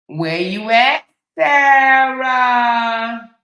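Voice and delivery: loud, high calling out. Pretend. Hunting the ex-wife online, loud calling out. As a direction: call out as if searching a house.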